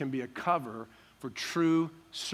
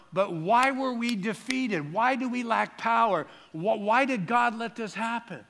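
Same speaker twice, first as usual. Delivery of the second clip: high-pitched, distressed questions to God; pouring out his heart; 7 semitones higher, 3 dB louder than usual. Acted. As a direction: demand to know why, pleading. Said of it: "Joshua's pouring out his heart to God".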